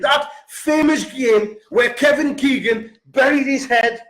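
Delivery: ordinary talk, nothing marked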